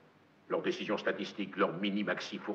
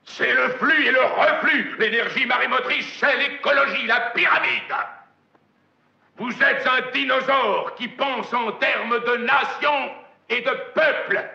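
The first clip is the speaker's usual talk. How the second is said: booming furious tirade, higher and louder; hammy stage actor; 12 semitones higher, 8 dB louder than usual. Acted. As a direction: rage at him like a tyrant. Furious executive's tirade, shouting.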